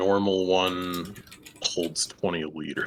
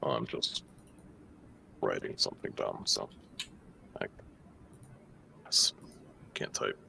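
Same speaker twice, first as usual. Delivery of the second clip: muttering; 9 semitones lower, 8 dB quieter than usual. Real, not acted. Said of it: "He's muttering to himself".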